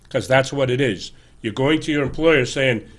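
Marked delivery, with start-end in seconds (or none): none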